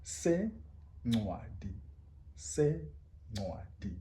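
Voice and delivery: Sencwadi very slow with the c click; isiXhosa click. Shown as a demonstration. Teacher modelling Sencwadi slowly. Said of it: "front of the tongue".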